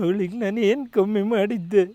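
slow, drawn-out crying that climbs; tearful lament. Acted. Abandoned husband asking what he did wrong, crying. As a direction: wail it to God, tearfully.

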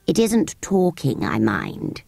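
haughty sand fairy. Acted. Sand fairy lays down terms, low slow voice. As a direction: set terms loftily.

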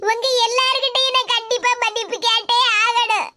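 tearful insistence; weeping, sobs between phrases; crying. Acted. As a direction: insist on apologizing through sobs. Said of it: "முதல்ல அழுகை நிப்பாட்டு".

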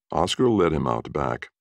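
usual speaking voice

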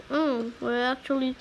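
usual speaking voice

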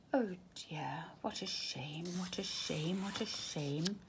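soft whispered sigh of regret; mock sad whisper. Cat whispers sadly as he is sent away.

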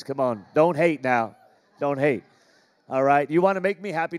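ordinary talk

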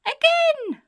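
put-on very high, loud baby squeal; piercing toddler demand; baby shouting. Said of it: "The baby laughs and shouts".

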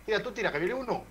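high urgent call, enemy incoming; grenade warning yell. Real, not acted. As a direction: shout the order in a panic. Urgent call during firefight.

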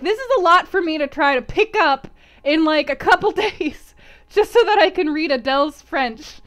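loud, high, overwhelmed complaint; half-laughing exasperated rant; exasperated protest. Retold or imitated. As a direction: plead your case, worked up and loud. Acting out her own exasperation.

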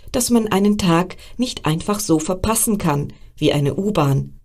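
ordinary delivery

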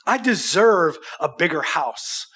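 ordinary talk, nothing marked

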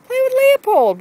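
high, loud urging; coaxing Marley to play with Leopold; urging the dog. Real, not acted.

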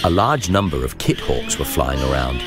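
plain reading voice

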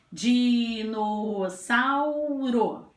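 "Dinossauro" drawn out as "Diii-nooo-sauu-ro"; four long linked beats on "Dinossauro"; slow, stretched. Shown as a demonstration. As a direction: say "Dinossauro" very slowly, holding every vowel. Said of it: "Vou falar a palavra devagar".

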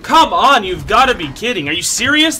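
loud, high protest; exasperated shouting. Real, not acted. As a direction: cry out in disbelief at the game. Exasperated by game death.

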